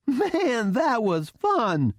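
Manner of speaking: high-pitched, gleeful whoop; thrilled, high female character voice; excited exclamation. A narrator's reading. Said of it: "she exclaimed".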